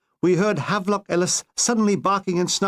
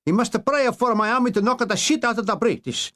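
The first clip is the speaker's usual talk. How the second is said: much higher, hammed-up Italian accent, ordering; a panicky dictator barking orders; Italian Mussolini; 5 semitones higher than usual. Dictator demanding prayers from the Pope, in a much higher mock Italian accent.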